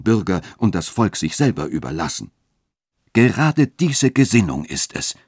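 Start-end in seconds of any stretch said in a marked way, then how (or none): 3.1-5.1 raised, higher-pitched indignant churchman; worked-up, higher voice of protest. A narrator's reading. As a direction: object heatedly. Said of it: "sagte der Dechant aufgebracht".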